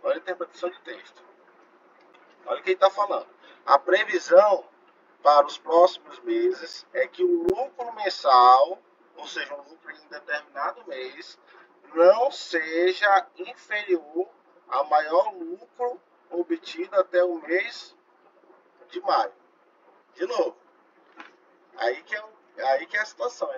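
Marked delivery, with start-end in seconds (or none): 3.7-8.8 loud read-out with stretched words; emphatic reading aloud. Real, not acted. Teacher stressing what the exam text says, emphatic slow read-out. 11.9-19.3 slow, drawn-out read-out of a question; emphatic reading aloud. Real, not acted. Teacher reading the forecast condition to students, slow emphatic read-out.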